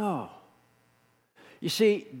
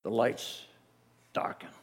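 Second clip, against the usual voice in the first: ordinary talk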